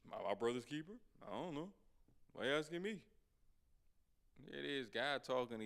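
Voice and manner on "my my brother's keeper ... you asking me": acting out a defiant comeback; mock back talk, tossed off as questions. Cain answering God back, mildly defiant. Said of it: "he had no problem talking back".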